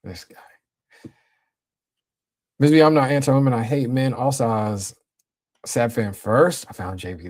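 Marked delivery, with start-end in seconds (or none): 0.0-1.1 1 whispered aside. Real, not acted. Muttered aside about a chatter.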